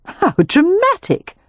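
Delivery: high, slow, scoffing; dismissive. A narrator's reading. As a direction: brush it off with a light laugh in the voice. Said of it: "with a dismissive laugh".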